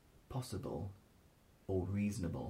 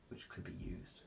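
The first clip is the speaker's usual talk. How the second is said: whispering; 8 dB quieter than usual. Real, not acted. Joking aside about dialect today.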